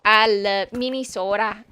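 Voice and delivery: a light Minnesota accent, long round o. Shown as a demonstration. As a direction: play up the Upper Midwest vowels, loud. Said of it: "saying it in the accent".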